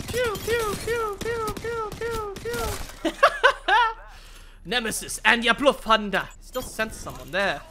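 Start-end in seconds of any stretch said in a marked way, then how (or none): 0.1-3.1 high, mouth-made laser pews; playful high pew pew gun sounds; mock gun noises. Gun sound effects while playing. 3.2-4.6 high laugh. Real, not acted. Laughs after pew sounds.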